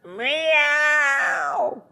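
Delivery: long, high-pitched meow; cat's meow. A narrator's reading. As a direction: meow as a cat attempting to crow. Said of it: "and said".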